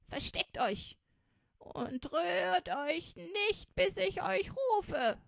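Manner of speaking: put-on loud, high, commanding old robber woman; urgent old woman. A narrator's reading. Old woman sends them into hiding as robbers come, loud and urgent. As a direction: order them away in alarm, stretching the last warning.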